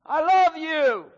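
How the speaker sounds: high, loud, drawn-out shout; full-voiced yell. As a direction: yell it as loud as you can to the far hillside. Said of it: "he goes back up there and he yells".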